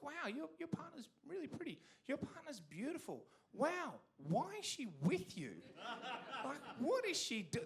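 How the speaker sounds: high, airy mock amazement; mock gushing. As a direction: gush like a nosy colleague. Colleagues gushing at party.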